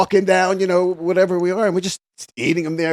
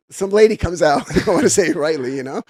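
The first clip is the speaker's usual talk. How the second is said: normal talking voice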